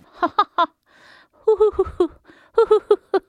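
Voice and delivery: high, loud cackling laugh turning into hoots; mocking laughter. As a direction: cackle at the old woman, rising to hoots. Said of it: "the girl began to laugh".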